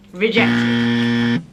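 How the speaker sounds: deep robotic rejection; cold rejection voice. Retold or imitated. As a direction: stamp it down like a machine denying the request. Amazon's reply to her appeal.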